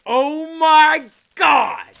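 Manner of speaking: loud, high, drawn-out joy; excited shout. Real, not acted. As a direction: shout it out with glee. Excited about paid jobs.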